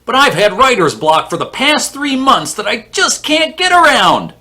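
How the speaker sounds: high, loud, over-the-top complaint; mock whining writer. Mimicking writers who post about their block, mock whining.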